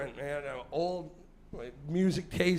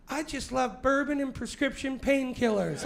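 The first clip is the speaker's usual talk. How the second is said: higher pitched boast; rock and roll wife; 7 semitones higher than usual. Retold or imitated. Comic quoting his younger wild wife, higher boastful voice. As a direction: brag like a wild party girl. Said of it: "says things like".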